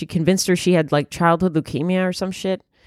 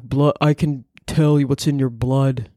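put-on low, level voice; Elizabeth Holmes; 4 semitones lower than usual. Founder telling a patient blood test results, fake deep voice. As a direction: fake deep voice, solemnly pronouncing. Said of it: "Elizabeth Holmes used to sound like that".

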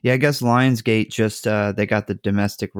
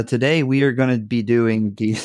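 ordinary talk

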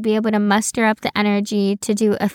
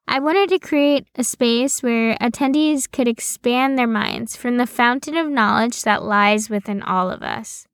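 ordinary talk, nothing marked

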